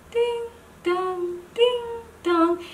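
high sing-song doorbell ding-dong; doorbell chime. Retold or imitated. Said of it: "and it would go".

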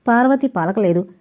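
ordinary delivery, nothing marked

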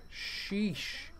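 usual speaking voice

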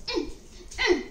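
high frightened cries; children crying. A narrator's reading. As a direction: plead to be let out. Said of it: "cried the children".

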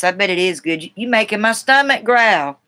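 ordinary talk

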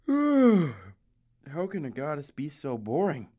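sighs, then drags the line out slowly in boredom; utterly unimpressed, heaved out; a slow, weary jab at a dull goddess. Acted.

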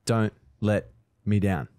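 "Don't let me down" broken by pauses, low; three separate beats. Shown as a demonstration. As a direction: count out "Don't, let, me down" beat by beat. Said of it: "That's three syllables too".

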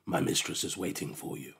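low, soft otherworldly beast; ominous low creature voice; eerie creature. A narrator's reading. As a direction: speak as a strange creature, hushed and low. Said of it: "its voice sounded like a thousand voices speaking together".

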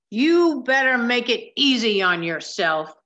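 loud, harsh yell at a passenger. A narrator's reading. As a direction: bark it out as an angry bus driver. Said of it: "yelled Mister Blake".

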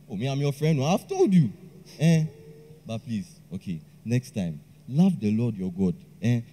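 low, steady sermon tone; lightly preachy. Acted. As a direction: lecture him like a pastor. Preaching to heartbroken friend.

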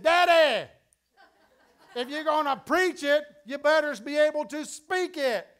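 put-on high, loud little girl's preaching voice; little daughter preaching. Daughter preaching to her tired daddy, high and loud. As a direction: preach at Daddy like a small child. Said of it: "she began to preach a little sermon to her daddy".

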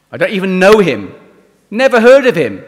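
loud high denial; oaths and curses. Retold or imitated. Peter denying Jesus with curses, loud and high. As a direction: hurl the denial out, cursing. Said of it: "with oaths and curses".

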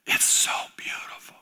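hushed, reverent whisper; awed whisper. Real, not acted. Preacher recalling Galilee, awed whisper. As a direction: breathe it out in awe.

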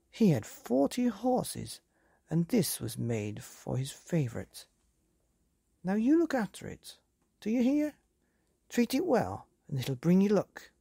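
high-pitched, lightly voiced old woman; a proud granny telling family history; old grandmother. A narrator's reading. Grandmother tells Billy of his great-grandfather.

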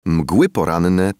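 plain reading voice

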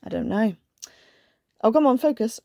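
usual speaking voice

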